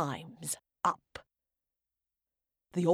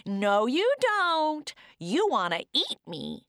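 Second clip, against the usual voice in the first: gingerbread man voice; 10 semitones higher than usual. Acted.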